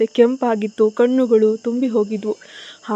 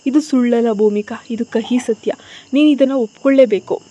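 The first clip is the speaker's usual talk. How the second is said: usual speaking voice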